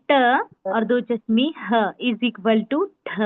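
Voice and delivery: slow, deliberate letter equation; slow sound demo. Shown as a demonstration. Teacher to students, slowly showing how ठ forms. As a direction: draw out each part so the class hears ठ. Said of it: "ठ sound हो रहा है".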